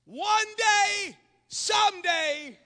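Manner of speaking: high, drawn-out daydreaming voice; mock wishful promise. Young people with destination disease, mock-wishful. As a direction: send up a dreamer putting things off. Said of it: "I call it the destination disease".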